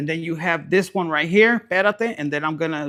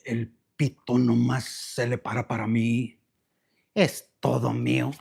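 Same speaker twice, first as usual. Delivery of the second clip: lower, sly possessive boast; smug possessive lover; 6 semitones lower than usual. Pretend. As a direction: purr it like a secret. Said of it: "You're like".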